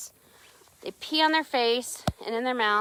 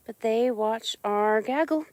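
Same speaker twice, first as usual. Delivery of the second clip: ordinary talk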